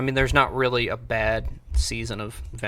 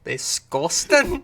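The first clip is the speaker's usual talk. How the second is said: put-on Scottish accent; 3 semitones higher, much slower than usual. Repeating the Scottish catchphrase.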